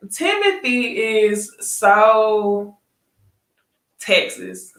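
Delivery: drawn out. Real, not acted. Building up to Timothy's accent.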